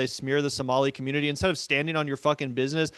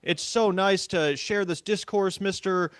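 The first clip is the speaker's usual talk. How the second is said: mock flattery; 4 semitones higher than usual. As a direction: fawn over him in mockery. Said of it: "complimenting and saying".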